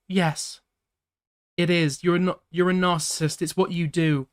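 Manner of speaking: put-on angry ex-girlfriend.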